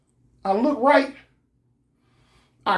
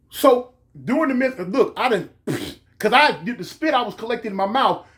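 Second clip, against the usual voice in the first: usual speaking voice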